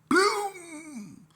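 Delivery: high, drawn-out yelled boom; imitated hit, shouted and ringing out; loud impact sound. Storyteller sounding the drumstick hitting, loud boom.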